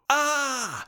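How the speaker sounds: high, loud cry of outrage; giant's scream. A narrator's reading. Giant finds what is in the sack, screaming. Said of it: "schrie der Riese".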